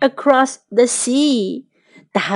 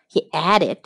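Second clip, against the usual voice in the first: ordinary delivery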